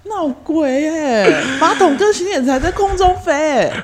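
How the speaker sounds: high laughing disbelief. Real, not acted. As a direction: laugh at how absurd it is. Mocking the absurd claim.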